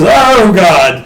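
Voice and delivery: a hint of a startled voice; gasping god voice. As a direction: blurt it out, startled. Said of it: "He goes".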